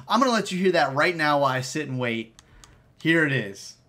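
normal talking voice

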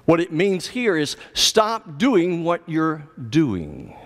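ordinary talk

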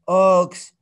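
"ox" hit hard and loud; emphatic word. Shown as a demonstration. Teacher stresses "ox" loudly to make the point. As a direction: punch the word "ox" home. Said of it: "it has been called ox".

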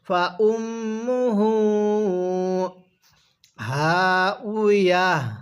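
ফা উম্মুহু হা উইয়া drawn out slowly, syllable by syllable; slow Quran teacher model reading; slow recitation demo. Shown as a demonstration. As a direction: stretch every sound of হা উইয়া for students to repeat. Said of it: "তাহলে আমার সাথে পড়ুন".

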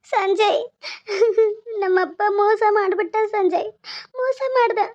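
tearful, betrayed daughter pouring it out; crying. Acted. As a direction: sob between the phrases. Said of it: "ಯಾಕೆ ಹತ್ಕೊಂತ ಬಂದಿದ್ಯಾ". (ಬಿಕ್ಕುತ್ತಾ ಅಳು)

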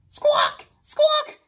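shouted high penguin squawk; loud, high squawking call. Acted. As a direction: call back like a penguin parent. Penguins calling back.

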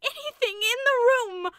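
high, loud, horrified insistence; obstinate horror. A narrator's reading. Rebecca insists the shadow is not from the room, loud horrified insistence. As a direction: insist in stubborn terror. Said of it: "repeated Rebecca with obstinate horror".

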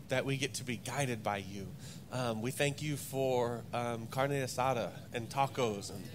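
soft, low prayer voice. Acted. Pastor praying over the congregation, soft and low. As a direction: pray quietly, reverent and unhurried.

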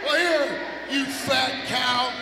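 normal talking voice

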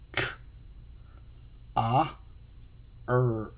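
"K, ah, er" said as separate sounds; teacher sounding out the c sound. Shown as a demonstration. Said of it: "it makes the K sound".

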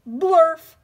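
blurted out loud and goofy; loud silly word. Acted. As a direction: bark the nonsense word out. Forced to read nonsense word.

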